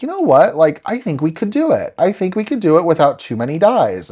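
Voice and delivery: bright, eager self-pep talk; excited. Retold or imitated. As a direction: talk yourself into it, thrilled. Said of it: "And I'm like".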